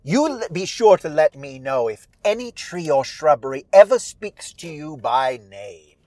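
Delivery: put-on theatrical old widow, disdainful; Old Widow Holcomb. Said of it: "Holcomb says".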